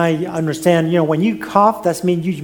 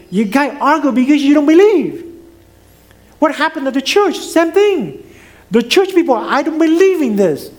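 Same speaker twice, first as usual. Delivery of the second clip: high, raised preaching voice; 6 semitones higher than usual. Acted. Pastor telling the congregation about the church, raised preaching. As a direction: lift the pitch and press the point.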